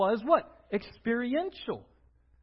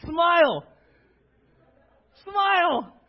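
higher, louder coaxing smile; urging a smile; 9 semitones higher, 10 dB louder, slower than usual. Real, not acted. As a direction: get the room to grin.

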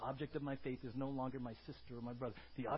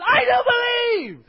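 full scream, far higher and louder; top of your lungs; 25 dB louder than usual. Shown as a demonstration. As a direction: scream it at the door. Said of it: "scream at the top of your lungs".

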